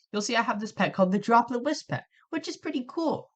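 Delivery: ordinary talk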